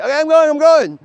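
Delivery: high, loud, frantic surrender; terrified high-pitched yielding; panicked giving in. Retold or imitated. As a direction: squeal it like someone with a gun to their head. Gun pointed at your head.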